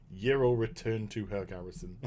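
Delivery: very low, soft deadpan; mock subtitle narration. Subtitle at the end of the cutscene, low deadpan. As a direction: read it like a quiet closing caption. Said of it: "some sort of subtitle that came up".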